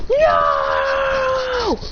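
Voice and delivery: high, long, held "no"; mock anguished scream. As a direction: wail it out in mock despair. His new eye power reverts.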